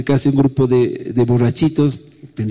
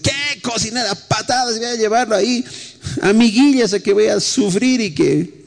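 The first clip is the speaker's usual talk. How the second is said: mock angry father; 8 semitones higher than usual. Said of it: "algunos papás deben decir".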